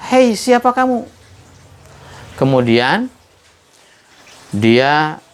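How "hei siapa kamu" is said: high call coming back from far off; echo shout. Retold or imitated. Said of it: "muncul lagi suara itu".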